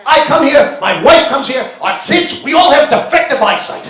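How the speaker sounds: high, loud furious rant; shouting at the clerk while storming out of the store; angry shouting. Retold or imitated. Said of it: "storming out of the store and screaming".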